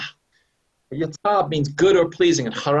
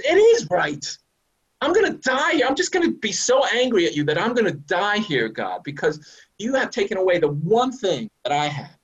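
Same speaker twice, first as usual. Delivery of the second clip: angry Jonah; 6 semitones higher than usual. Acted. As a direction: talk back to God in a defiant rage. Jonah voiced answering God angrily.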